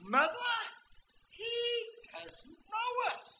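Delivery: put-on high, lightly quavering old man; old man calling wife. Old man tells his wife the preacher forgot them, high. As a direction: call out to Mother, dismayed.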